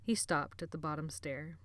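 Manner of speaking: ordinary delivery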